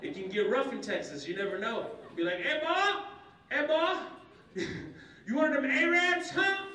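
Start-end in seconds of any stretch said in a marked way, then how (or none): 2.4-6.7 high, loud Texan interrogator; Texan bully. Acted. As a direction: challenge in a pitched-up Texas voice. Menacing Texan confronts him.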